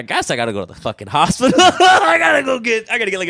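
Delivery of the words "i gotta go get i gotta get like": mock shout. Real, not acted. Admitting to his friend he needs help, mock yell. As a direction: yell it in joking exasperation. Joking about needing a nutritionist.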